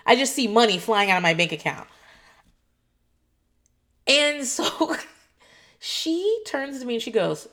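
ordinary talk, nothing marked

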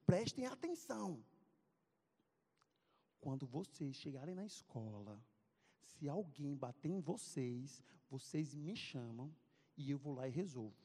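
low, measured instructions; big tough friend. Retold or imitated. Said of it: "e disse bem assim".

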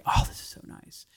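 breathy whisper of contentment; hushed, pleased murmur; whispered delight. Retold or imitated. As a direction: whisper it like a happy sigh. Self-quote, whispered.

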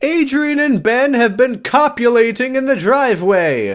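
high, slow proclamation voice; town crier. Pretend.

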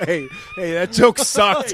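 loud, high heckler. Pretend. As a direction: yell it like a comedy club heckler. Heckler bit.

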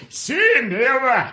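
slow, high strained mock Scottish "Sayonara" with a laugh; laughing, slow, strangled Scottish goodbye; dying Scottish accent. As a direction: drag out "Sayonara" in a dying Scotsman voice. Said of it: "Why is yours, like, dying?".

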